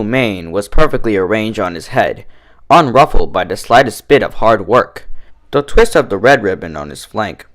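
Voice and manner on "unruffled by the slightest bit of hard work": plain reading voice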